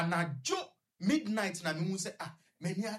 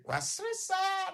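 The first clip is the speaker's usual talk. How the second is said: imitating his laugh; much slower than usual. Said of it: "ɔstart sere".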